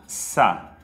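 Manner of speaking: "Sa" with s and a short open a; Arabic syllable sa. Shown as a demonstration. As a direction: model "Sa" crisply for students. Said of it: "com Fatha". ("Sa" com s e a aberto)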